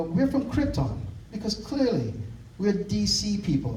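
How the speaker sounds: mock Russian superhero pride; Russian woman. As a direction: lift the voice high to play her boast. Voicing the Russian women's boast.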